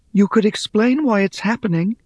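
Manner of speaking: high, pleading voice; pleading Rhea. Acted. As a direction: beg for help, reaching up.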